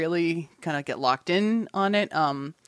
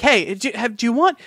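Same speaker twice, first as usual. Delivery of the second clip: higher, louder sales pitch, finger guns out; salesy pitch; 6 semitones higher, 4 dB louder than usual. Retold or imitated. Pitching the queer sci-fi podcast to friends, cheesy salesman. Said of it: "finger gun type motions ... hear it in my voice".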